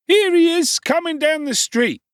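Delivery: very high, loud call; a bystander calling out across the crowd. Acted. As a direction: point him out over the noise.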